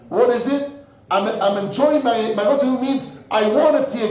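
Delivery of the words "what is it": usual speaking voice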